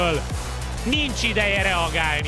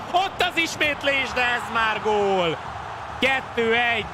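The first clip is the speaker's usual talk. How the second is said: excited goal call; 4 semitones higher than usual. Acted. Goal called live.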